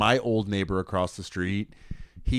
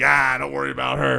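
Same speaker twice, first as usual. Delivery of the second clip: dismissive; 4 semitones higher, 3 dB louder than usual. Retold or imitated. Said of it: "We're like".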